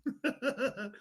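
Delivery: soft high-pitched giggle; real chuckle. Real, not acted. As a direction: giggle softly to yourself. Laughing after the played clip.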